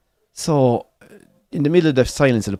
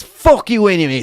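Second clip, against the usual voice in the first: higher, raised-voice mock outburst; retold angry send-off; angry insult; 8 semitones higher, 4 dB louder than usual. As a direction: spit it out as an indignant retort. Retells stranger's angry parting insult.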